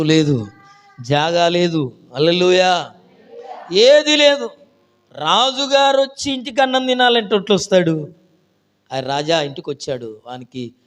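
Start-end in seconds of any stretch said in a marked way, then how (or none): 3.7-8.1 very high, loud rhetorical cry; preaching at full force. Acted. Preacher stresses the youth's poverty, loud and fervent. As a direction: build the crowd's amazement.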